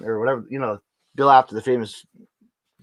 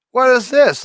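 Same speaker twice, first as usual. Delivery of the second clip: mock disbelief; 12 semitones higher, 7 dB louder than usual. Mock disbelief at Twitter audio.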